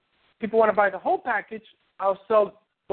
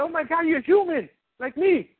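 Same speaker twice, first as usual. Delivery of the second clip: mock surprised fan; 8 semitones higher than usual. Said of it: "this lady said".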